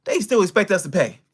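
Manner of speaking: ordinary talk, nothing marked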